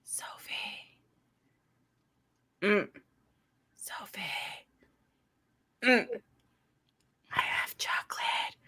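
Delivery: soft whisper, slow; whispered tease. Retold or imitated. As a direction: coax her awake with a whisper. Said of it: "I'll be like, whisper".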